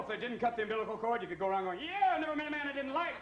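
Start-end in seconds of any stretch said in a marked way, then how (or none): 1.8-3.2 high folksy quip. Acted. Baby with uncut cord greeting people, high folksy voice.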